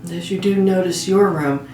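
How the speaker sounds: slow, measured meditation voice with long pauses; guided meditation. Real, not acted. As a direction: guide a listener gently toward sleep, phrase by phrase. Leads a guided sleep meditation.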